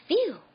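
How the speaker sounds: high, loud sigh of relief; relieved phew. A narrator's reading. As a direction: let out the day's boredom. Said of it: "said Sam".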